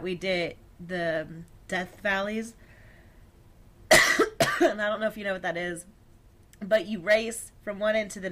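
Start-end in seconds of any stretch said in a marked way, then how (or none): 3.9-4.5 harsh cough; real cough, mid-sentence; coughing fit. Real, not acted. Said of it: "Sorry, I'm coughing so much".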